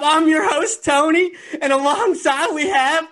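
high-pitched, loud, amped-up attempt at a Southern accent; badly faked Southern accent, pushed way up in pitch; failed Southern accent. Host opens the podcast to start off the show, badly faked Southern accent. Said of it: "trying to do a Southern accent".

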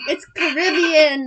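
slow, emphatic outburst; loud protest. Real, not acted. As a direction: cry it out in exasperated insistence. Insisting on her pronunciation.

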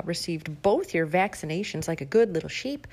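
ordinary talk, nothing marked

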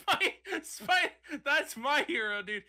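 high, loud, breaking up with laughter; helpless giggly outburst; laughing. Real, not acted. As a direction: laugh through the words, stumbling on the start. Cracking up while riffing.

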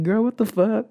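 high-pitched mock outrage; indignant comeback. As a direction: protest in high, acted-out disbelief. Acted quarrel, repeating the comeback.

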